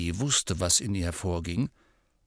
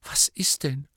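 whispered; 4 semitones higher than usual. A narrator's reading. Hermione comforting weeping Hagrid, in a whisper. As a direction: whisper it gently.

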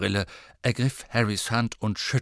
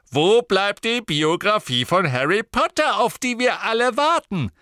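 high, loud theatrical outburst; eager exclamation; 10 semitones higher, 6 dB louder than usual. Acted. Warple gushing to Harry about his biography, eager exclamation. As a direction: declaim it high and insistent.

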